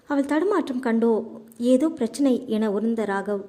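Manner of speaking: ordinary delivery, nothing marked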